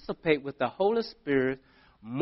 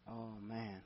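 very soft, low moan of dismay; acted reluctant groan; 5 semitones lower, 21 dB quieter than usual. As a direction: give in grudgingly, under the breath. Acted reluctant giving in to God.

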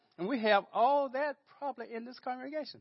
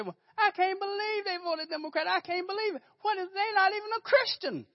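much higher, aghast mock gossip; mock outraged churchgoer; 12 semitones higher than usual. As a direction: play the scandalized church member, high and shocked. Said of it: "this year they say".

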